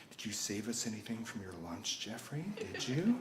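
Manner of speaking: very soft, low-volume taunt; menacing bully. Retold or imitated. Ninth-grade bullies to their pinned victim, quietly menacing. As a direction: lean in softly on a mock-threatening question.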